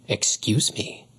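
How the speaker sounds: slow, breathy angry growl; offended lioness growling at the boy. A narrator's reading. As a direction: bite out the words coldly. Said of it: "she growled".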